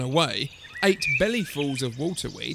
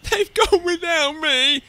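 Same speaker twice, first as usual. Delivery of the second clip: high, loud, drawn-out wail; wailing cry; 12 semitones higher, 6 dB louder than usual. A narrator's reading. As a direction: wail it like a child left behind. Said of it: "And he cried".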